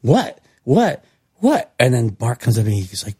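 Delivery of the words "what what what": rising, ever higher puzzled what; each what climbing in confusion; confused what. Retold or imitated. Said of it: "sitting here going".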